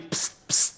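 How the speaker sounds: whispered psst psst; mock whispered psst. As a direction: hiss psst like calling someone. Imitating an even shorter call.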